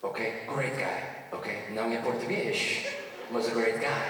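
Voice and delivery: put-on a hushed old man confiding; grandpa voice. Grandpa whispers his secret to his grandson, low and soft. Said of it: "he goes".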